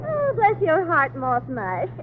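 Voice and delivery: soft, slow honeyed flattery; soft slow syrupy coo; sugary coo. Acted. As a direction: purr it sweetly. Sugary flirting, dropping soft.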